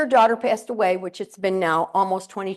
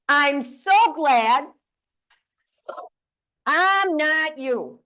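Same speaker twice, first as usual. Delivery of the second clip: very slow, drawn out, a bit louder; slow modeled line; 5 semitones higher, 4 dB louder, much slower than usual. Shown as a demonstration. Speaker leading the audience in a slow chant-along. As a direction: spell each word out slowly for the crowd to copy. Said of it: "Look at her and say".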